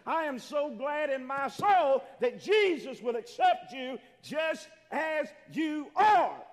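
loud, slowing to hammer the last words; shouted preaching, each closing word drawn out; full-force preaching. Acted. Preacher declaring his gladness to the congregation, full-force preaching.

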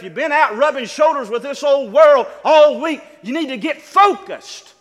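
loud, driving sermon build to the congregation; preaching at full force. Acted. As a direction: preach it out loud, pushing the church to get ready.

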